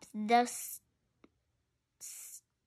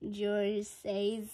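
usual speaking voice

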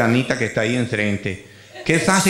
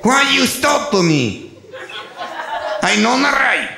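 higher, slow indignant broken English; 7 semitones higher, much slower than usual. Retold or imitated. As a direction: protest in clumsy English. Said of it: "ahí empieza un inglés raro".

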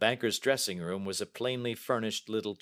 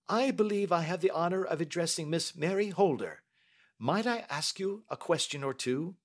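high, courteous detective; Holmes; 9 semitones higher than usual. Acted. Detective introducing his questions to the young lady, courteous. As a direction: ask politely in a raised, formal voice.

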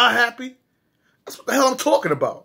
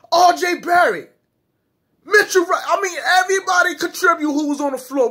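hyped shout; 7 semitones higher than usual. Real, not acted. Fan hyping up the Knicks players, shouting. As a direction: shout the names like a fan on fire.

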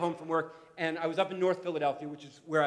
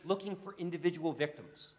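normal talking voice

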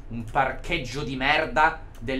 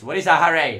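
ordinary delivery

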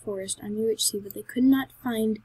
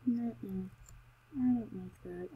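ordinary talk

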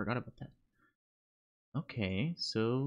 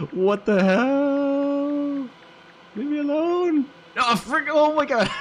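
very high, loud, screaming panic; terrified shriek; 14 semitones higher, 10 dB louder, slower than usual. Real, not acted. Gamer fleeing the ghost, shrieking high and loud in terror. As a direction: shriek for your life.

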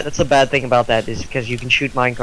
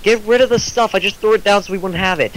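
higher-pitched raised call to a teammate; mild yelling; 6 semitones higher than usual. Real, not acted. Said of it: "You don't have to yell".

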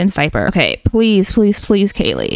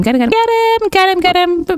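high-pitched repeated pleading; mock panic, high and urgent; frantic urging; 12 semitones higher than usual. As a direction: urge in a rising panicky squeal. Urging sim to grab baby.

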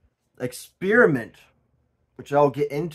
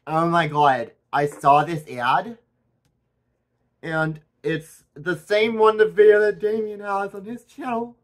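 higher, louder excited roommate voice; imitating roommate; 6 semitones higher, 8 dB louder than usual. Imitating the roommate seeing the Temu ad, high excited gush. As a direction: gush like the giddy roommate, pitched up. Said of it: "She talks like, exactly like this".

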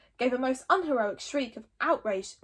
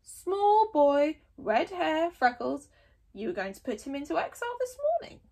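creaky elder, higher and quick; an old sage listing details; old man Wrinkly; 6 semitones higher, 4 dB louder than usual. Acted. Old Wrinkly reminding the chief, old man voice.

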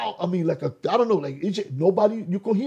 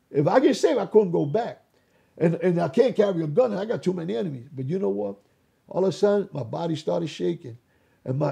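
usual speaking voice

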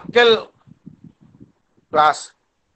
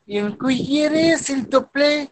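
put-on mother feeding baby; 6 semitones higher than usual. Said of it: "comme un maman donne, euh, la nourriture à son bébé".

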